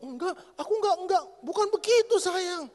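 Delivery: high, flustered, laughing it off; nervous laughing denial. Retold or imitated. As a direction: backpedal fast with a nervous laugh. Husband backpedalling to his hurt wife. (suami menyangkal ke istrinya sambil tertawa gugup)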